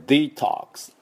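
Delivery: "デトックス" as dee-TAHKS with crisp final ks; native detox demo. Shown as a demonstration. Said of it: "この音です".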